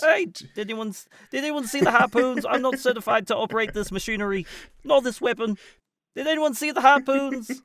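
high-pitched Korg character voice. Acted. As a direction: play Korg, pitched well up and lilting. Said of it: "saying that voice on this podcast".

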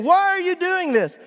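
high, loud wail of protest; hammed-up high doggy outcry; betrayed dog. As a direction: plead like a wronged little pet. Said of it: "she is looking at me saying".

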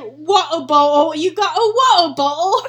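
loud mock British question; hammed-up British accent, rising at the end. Reading a British people tweet, loud British accent. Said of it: "British people be like".

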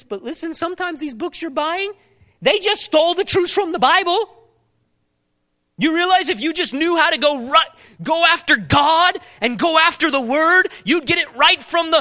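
loud, high, urgent preacher's call; forceful preaching. Acted. Preacher pressing the congregation on seeking wisdom, forceful. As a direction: drive the question home from the pulpit.

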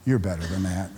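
put-on an older man quietly correcting a young player; gentle rebuke. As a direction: say it kindly, like a mentor. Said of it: "came up afterwards and said".

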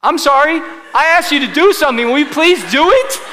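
loud, high-pitched pretend telling-off; mock scolding. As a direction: playfully chide an audience that won't join in. Mock scolding of unresponsive congregation.

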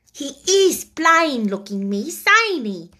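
blunt and homely, a plain little maid; Becky the maid. Acted.

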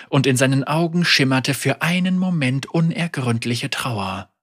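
ordinary delivery, nothing marked

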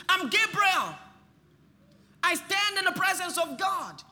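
high, loud, ringing declaration as Gabriel; Gabriel proclaiming. Acted. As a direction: announce yourself as the archangel. Preacher acts Gabriel announcing himself.

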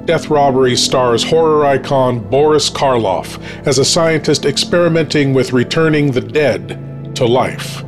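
usual speaking voice

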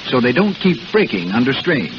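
normal talking voice

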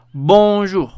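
"bonjour" marcando la o nasal, como hecha con la nariz; nasal francesa. Shown as a demonstration. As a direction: subrayar la nasal de "bonjour" para que se oiga. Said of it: "estamos escuchando bonjour". (demostración del sonido nasal en "bonjour")

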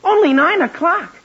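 surprised. Acted. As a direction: react incredulously to the time. Boy surprised at the early hour.

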